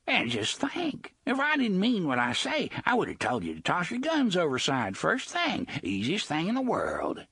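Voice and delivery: sly high wheedling pirate voice; mocking pirate villain coaxing; Gasher the pirate. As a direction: purr the threat like a grinning cutthroat. Gasher's cajoling threat.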